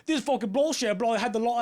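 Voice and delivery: loud, high mock-British tirade; unintelligible British rant. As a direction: garble an angry British worker's rant. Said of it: "I have no idea what he's saying".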